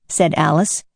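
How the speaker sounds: ordinary delivery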